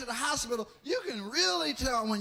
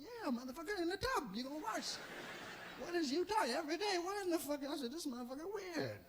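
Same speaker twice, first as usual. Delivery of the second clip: softer, higher annoyed mutter; quiet irritation; 5 semitones higher, 9 dB quieter than usual. Retold or imitated. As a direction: grumble back under breath. Annoyed patient answers softly.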